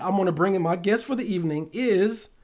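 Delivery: usual speaking voice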